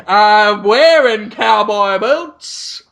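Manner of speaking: slow, hammed-up American drawl; mock American accent. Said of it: "You sound so".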